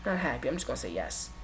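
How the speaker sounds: barely voiced, as if only thinking it; quiet private admission; inner voice. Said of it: "in their head, they stopped and said".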